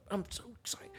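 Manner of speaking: high whispered puppy thoughts; puppy's voice. Retold or imitated. Trainer voicing the puppy dropping the sock, high whisper. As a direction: voice the excited pup in a tiny whisper. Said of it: "They're probably gonna drop it like".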